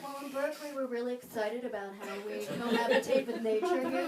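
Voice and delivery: a hint of a dreamy local; mock earnest Berkeley friend. Friend explains Berkeley nature life, soft and earnest. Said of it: "she's like".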